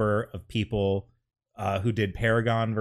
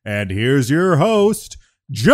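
booming ringmaster announcer, higher, louder, slow; radio voice; 6 semitones higher, 8 dB louder, slower than usual. Pretend. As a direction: announce the big show like a ringmaster. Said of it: "I'm good at radio voices".

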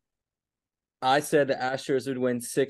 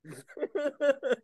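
laughing; 10 semitones higher, 4 dB quieter than usual. Real, not acted. Laughing at friend eating.

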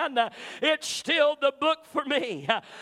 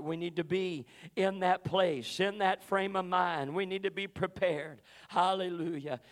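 quiet heartfelt exhortation after the shouting; soft earnest preaching; 8 semitones lower, 5 dB quieter than usual. Acted. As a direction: bring it down low and plead with them gently. Preacher drops to soft appeal.

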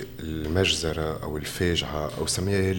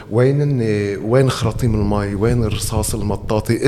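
louder, demanding where; angry rhetorical questions; 3 semitones higher, 6 dB louder than usual. Real, not acted. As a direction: hurl the questions. Angry questions about security force.

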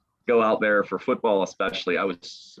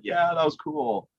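dazed and starstruck; 8 semitones higher, slower than usual. Retold or imitated. Dazed reply, high and slow.

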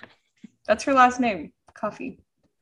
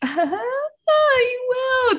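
squealy high reply. Retold or imitated. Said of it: "and I'm like".

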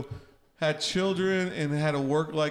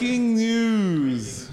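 higher, stretched-out mock news bulletin; drawn-out mock announcement; 5 semitones higher, 4 dB louder, much slower than usual. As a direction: insist on it, very slowly, over the others. Repeats mock announcement after being interrupted.

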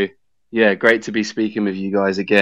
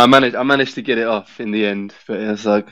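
usual speaking voice

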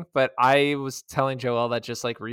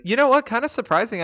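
excited; 10 semitones higher, 4 dB louder than usual. Real, not acted. Sudden excited interjection.

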